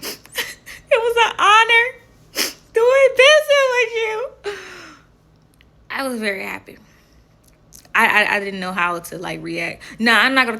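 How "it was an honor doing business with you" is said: laughing with joy. Retold or imitated. Thrilled on being recognized.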